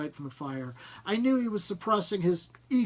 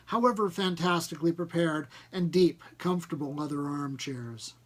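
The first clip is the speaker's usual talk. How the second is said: plain reading voice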